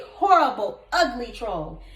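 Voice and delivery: usual speaking voice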